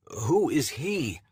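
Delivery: breathless, desperate plea. Acted. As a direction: plead in desperation, slowly. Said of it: "desperation seeps into my tone".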